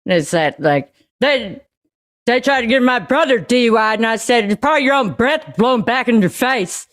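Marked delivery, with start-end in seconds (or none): 1.2-6.8 lightly voiced brash neighborhood lady; local lady voice. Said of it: "that kind of energy attitude".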